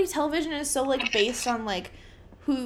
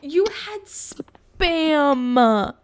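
disgusted; 3 semitones higher, 7 dB louder, much slower than usual. Real, not acted. Disgust at friend's dinner photo.

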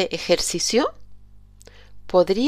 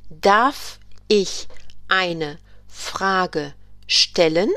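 very slow, clear question; slow model question; much slower than usual. Shown as a demonstration. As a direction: draw out the question for learners. German teacher models a question.

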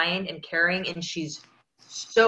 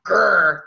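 short playful growl imitating a child; mock growl; 6 dB louder than usual. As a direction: growl like an annoyed toddler. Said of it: "she's doing this thing where she's going".

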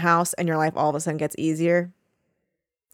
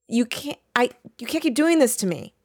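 high, mock-desperate protest; exasperated plea; 6 semitones higher than usual. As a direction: plead with the author to stop. Acts out despair at the book.

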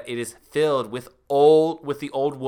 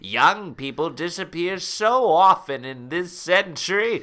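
menacing crone voice, louder mid-threat; sinister witch; 5 dB louder than usual. Acted. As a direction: threaten like an evil grandma.

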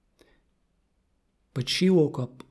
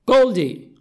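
high, very loud name call; insistent calling out; 10 semitones higher, 11 dB louder than usual. Acted. Kat calling the fish that gives no answer, loud. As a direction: shout the name to demand attention.

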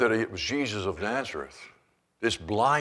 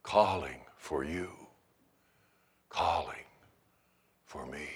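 tender hymn recall; 5 semitones lower, 5 dB quieter than usual. Real, not acted. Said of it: "think of that hymn".

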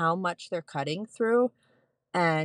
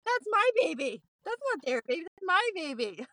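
much higher, proud exclaim; excited; 11 semitones higher than usual. Retold or imitated. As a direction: claim the baby with joyful pride. New mother claiming her baby.

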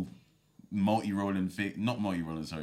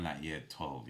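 usual speaking voice

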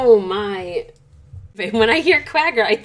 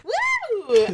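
excited whoop. Real, not acted. Cheering a near guess.

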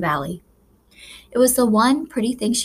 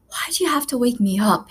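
sighed; 4 semitones higher than usual. Acted. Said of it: "he sighed".

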